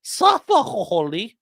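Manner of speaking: high, slowed fake Russian accent; mock Russian accent. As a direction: drag it out in a put-on Russian accent. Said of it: "doing Ethan Ralph in a Russian accent".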